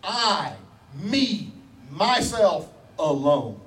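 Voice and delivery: "I", "me", "myself" as separate beats, then a slow "alone"; emphatic. Acted. Preacher voicing God emphasizing to his people, deliberate emphasis. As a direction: set "I, me, myself alone" apart word by word. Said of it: "emphasizing to his people".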